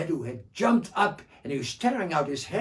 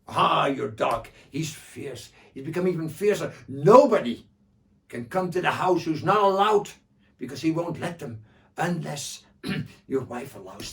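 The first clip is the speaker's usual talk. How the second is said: Joha voice; 2 semitones higher, 9 dB louder than usual. Acted. Joha describes the dog.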